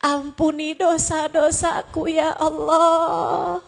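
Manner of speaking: drawn-out, pleading cry to God; acted sobbing supplication; tearful prayer. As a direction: plead for forgiveness as if weeping. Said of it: "dengan linangan air mata kamu bilang".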